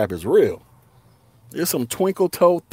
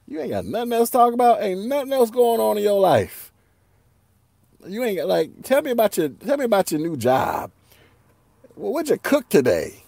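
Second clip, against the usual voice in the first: high-pitched, pleading complaint; mock exasperation; 7 semitones higher than usual. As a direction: plead in a raised pitch for another topic. Imagined pleading with cousin.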